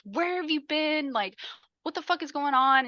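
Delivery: a partner freaking out, voiced in mockery; mock frantic. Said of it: "off the fucking walls".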